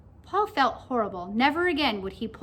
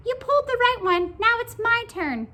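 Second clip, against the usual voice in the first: whining pigtail; 11 semitones higher, 5 dB louder than usual. A narrator's reading. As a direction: whine it in a tiny high creature voice. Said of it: "whined the pigtail".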